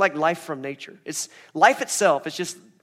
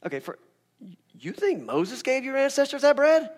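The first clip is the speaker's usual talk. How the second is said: dismissive Jesus; 7 semitones higher than usual. Retold or imitated. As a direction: brush off their Moses claim. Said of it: "He's like".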